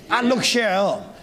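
normal talking voice

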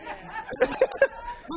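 a high airy burst of laughter; preacher laughing at the story; high breathy laugh. Real, not acted.

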